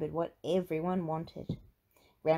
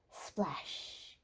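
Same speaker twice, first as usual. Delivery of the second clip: breathy whispered sound effect; whispered splash; 8 dB quieter than usual. A narrator's reading.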